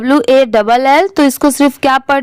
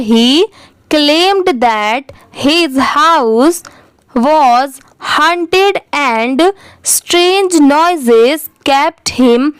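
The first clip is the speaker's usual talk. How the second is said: very slow reading, words set apart; careful model reading; much slower than usual. Shown as a demonstration. Teacher continuing the paragraph after a full stop, slow careful reading. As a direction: take the English word by word, pausing between. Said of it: "एक बार अच्छे से read करके".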